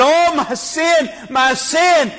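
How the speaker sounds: high, slow mock wail of an old man; hammed-up weeping old man; imitating a lamenting old man. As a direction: voice his cry of conviction. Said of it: "this guy was weeping and crying and lamenting".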